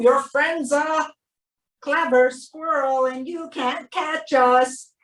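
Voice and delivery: ordinary delivery, nothing marked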